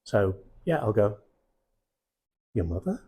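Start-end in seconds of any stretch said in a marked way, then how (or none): 2.5-3.0 "Your mother?" with an upward rising terminal; a curious, rising "Your mother?"; rising question. Shown as a demonstration. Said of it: "Upward rising terminal to make it into a question".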